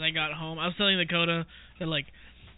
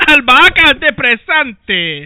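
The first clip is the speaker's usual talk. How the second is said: lucha libre announcer; 7 semitones higher, 19 dB louder, slower than usual. Pretend. Mock wrestling match introduction.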